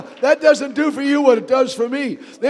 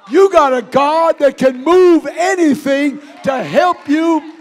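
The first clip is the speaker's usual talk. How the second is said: louder, higher rising preacher shout; preaching shout; 6 semitones higher, 6 dB louder than usual. Real, not acted. Preacher shouting to the church that God gives signs, rising. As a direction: build it up and call it out.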